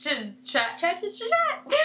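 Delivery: high, rising sing-song chatter; playful sing-song. Real, not acted. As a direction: bounce the chats up playfully.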